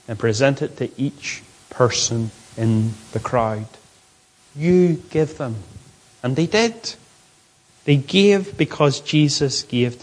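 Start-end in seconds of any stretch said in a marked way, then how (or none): 4.5-5.6 "You" long, then falling away; slow command weighted on "You"; stress on You. Acted. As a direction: press firmly on "You". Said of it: "It's stressed".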